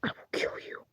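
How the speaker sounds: a voiceless, creepy whispered threat; menacing whisper. Acted. A creepy voice whispering a death threat to the player. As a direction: breathe the threat like a lurking killer.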